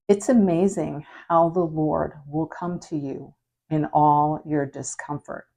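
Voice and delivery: normal talking voice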